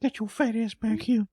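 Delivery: put-on very high, creaky old-man voice; Herbert. Cohost keeps the creepy old man going, very high. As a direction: call it out like a leering old man. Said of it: "Herbert the Pervert".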